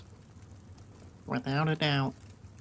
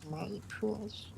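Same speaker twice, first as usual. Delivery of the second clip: soft, higher, creepy creature hiss; Gollum voice; 7 semitones higher, 9 dB quieter than usual. Pretend. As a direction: croon it like a creepy little golem. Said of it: "That little golem thing's creepy".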